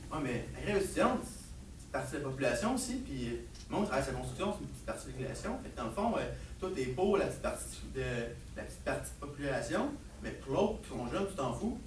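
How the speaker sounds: low, very soft argument; quiet reasoning. Acted. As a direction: lay out the logic in a hushed, low voice. Son quietly traps father with logic.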